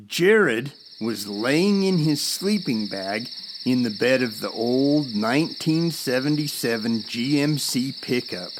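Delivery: usual speaking voice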